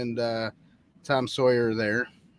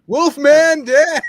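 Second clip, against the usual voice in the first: high, loud, drawn-out DJ yell; playful caps-lock shout; 14 semitones higher, 12 dB louder, much slower than usual. Pretend. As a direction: holler it like a wild radio jock. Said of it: "we're yelling again".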